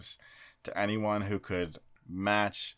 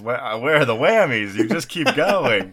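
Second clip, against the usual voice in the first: loud, higher-pitched mock alarm; alarmed game show host; 4 semitones higher, 10 dB louder than usual. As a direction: imitate a rattled host, loudly.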